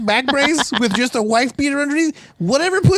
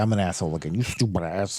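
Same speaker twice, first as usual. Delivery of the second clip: very low, soft grumble; mock gruff asshole, muttered down low; Darnell; 8 dB quieter than usual. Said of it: "He's like".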